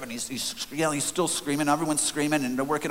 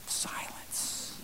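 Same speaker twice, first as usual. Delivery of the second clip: whispered, very soft; hushed whisper; 14 dB quieter than usual. A narrator's reading. Telling of the stunned ride away, whispering. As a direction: let it drop to a bare whisper.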